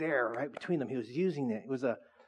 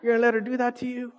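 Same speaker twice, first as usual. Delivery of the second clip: teasing laugh; 6 semitones higher than usual. Retold or imitated. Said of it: "I'm all".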